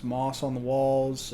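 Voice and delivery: ordinary talk, nothing marked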